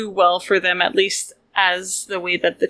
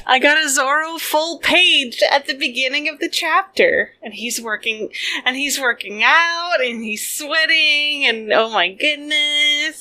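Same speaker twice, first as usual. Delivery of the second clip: higher-pitched, swooning excitement; fangirl gushing; 5 semitones higher than usual. Real, not acted. Host gushing over Zoro's workout page, fangirl excitement. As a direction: gush over a crush, drawing out the last words. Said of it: "my little Zoro fangirl moment".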